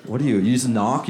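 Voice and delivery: normal talking voice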